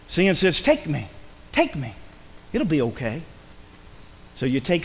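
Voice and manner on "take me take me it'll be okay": lightly voiced low, soft enticement; soft coaxing. Sin tempting the listener to take it, soft coaxing. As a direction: tempt gently, dropping the voice.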